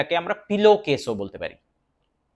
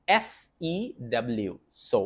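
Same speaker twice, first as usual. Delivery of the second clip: normal talking voice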